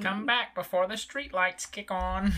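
high, slow 1950s Brooklyn mom. Retold or imitated. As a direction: play a nagging Brooklyn mother. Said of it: "That's what you sound like".

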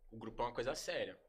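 a hint of the calm leader laying down the rules; calm mentor voice. Quoting T H explaining the crew is serious, mildly soft and calm. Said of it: "no mesmo jeito que ele era manso, nesse jeito de falar".

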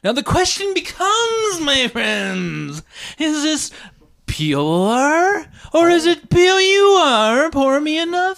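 very high, drawn-out theatrical wail; mock-dramatic proclamation. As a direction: pose a silly question as high melodrama. Mock-dramatic posing of a silly question.

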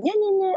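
high sing-song imitation of rowdy kids; high mocking kiddie chant; mock children's bawling. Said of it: "grölten rum".

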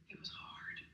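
breathy, hushed "it was hard"; whispered confession. Real, not acted. Pastor admitting a painful season, whispered. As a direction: drop to a whisper.

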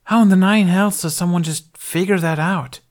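high, loud disbelieving outburst; astonished. A narrator's reading. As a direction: exclaim it in disbelief. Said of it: "in his surprise".

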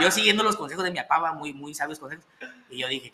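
ordinary talk

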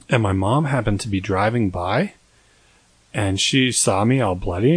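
usual speaking voice

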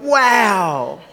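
laughing, high amazed wow; a little boy marvelling at the sunset; grandson's wow. Retold or imitated. Grandfather laughing as he imitates the toddler's wow. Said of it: "his little short arms went".

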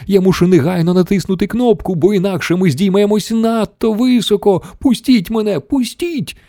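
high, climbing desperate plea; frantic yelling. A narrator's reading. Wonka desperate to reach the button, high frantic yelling. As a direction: plead frantically, pitch rising to the last word. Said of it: "репетував містер Вонка".

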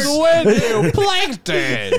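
very high squeaky cartoon voice; cartoon villain voice.

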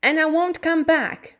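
high, loud parting shot; shouted reply. A narrator's reading. Mary leaving Colin's room, angry shout. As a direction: shout it back as you storm out. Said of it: "Mary shouted in reply".

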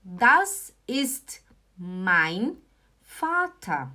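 very slow, with a lift on "Vater"; teacher modelling a new example slowly; slow model phrase. Shown as a demonstration. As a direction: stretch it out for learners. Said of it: "olha só o próximo exemplo".

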